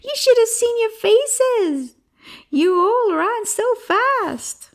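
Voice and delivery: very high, gleeful boy's mockery; laughing hard. Shepherd boy mocking fooled villagers, laughing hard. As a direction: crow with laughter, drawing out the end. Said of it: "Leo was laughing so hard he could barely stand".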